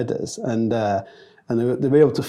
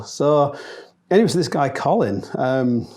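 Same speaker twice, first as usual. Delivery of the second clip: ordinary talk, nothing marked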